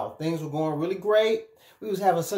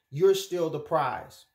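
normal talking voice

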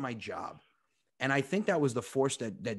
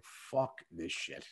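low, slow, quietly fed-up mutter; 5 semitones lower, 6 dB quieter, much slower than usual. Acted. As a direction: drag it out under your breath, totally done. Fed-up employee in a meeting.

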